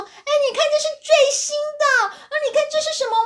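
put-on high showing-off child voice; bragging kid. Boy shows off his spinning tops to friends, high and bragging. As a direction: show off the new toy like a kid.

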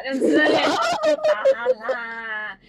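high, slow, laughing granny plea; laughing grandma. Retold or imitated. Grandma stopping mum's cane, high slow laughing. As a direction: laugh through the old lady's plea. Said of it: "婆婆就会".

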